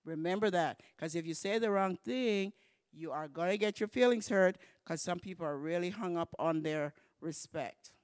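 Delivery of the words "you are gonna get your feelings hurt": usual speaking voice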